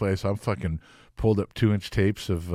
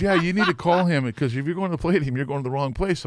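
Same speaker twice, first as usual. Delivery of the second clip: mock rocker telling him off, higher and fast; 8 semitones higher, 3 dB louder than usual. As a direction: play the older rocker setting him straight. [name] voiced, advising.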